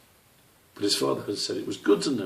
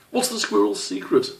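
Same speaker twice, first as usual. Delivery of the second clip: high, loud sudden blurt; a small squirrel blurting much higher and louder; 14 semitones higher, 6 dB louder than usual. A narrator's reading. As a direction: burst out with it, can't hold it in. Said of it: "Squish blurted out".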